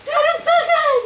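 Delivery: high, loud mock sobbing wail; crying for joy. Retelling how she cried when friends walked in, wailing. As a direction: imitate overwhelmed happy tears, very high. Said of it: "jumping up and down and crying".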